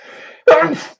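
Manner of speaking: sudden high, loud laugh; a high, loud laugh bursting out; burst of laughter. Real, not acted. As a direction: crack up mid-sentence. Giggles at his own line.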